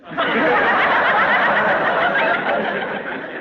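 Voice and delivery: high airy ghoulish laugh; eerie breathy cackle; creepy undertaker chuckle. Acted.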